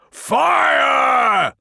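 very high, loud, drawn-out shout; full-throated battlefield yell; bellow. Acted. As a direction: bellow the command to open fire. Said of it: "bellowed Felix".

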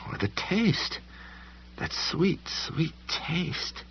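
high, hushed shaky voice; haunted inner voice. A narrator's reading. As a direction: whisper it like a memory you fear.

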